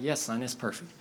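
a hint of a patient father; reassuring dad. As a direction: quietly settle a worried son. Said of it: "And the dad's like".

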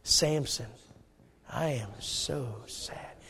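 slow, very soft, low lament; sorrowful. Acted. Preacher leading congregation in lament over Samson, sorrowful. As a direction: say it softly and mournfully for all to join. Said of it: "Would you say it with me?".

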